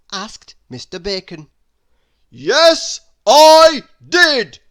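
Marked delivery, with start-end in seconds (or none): 2.3-4.6 very loud, high, slow yell; top-of-voice shout. A narrator's reading. Mister Noisy yelling at the baker before stopping himself. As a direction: yell it at full force, then cut off. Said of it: "shouted Mister Noisy at the very top of his voice".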